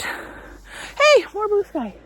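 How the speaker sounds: bright high exclamation; delighted. Real, not acted. Spotting blue sky in the snow, high delighted call. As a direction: pitch jumps way up in delight.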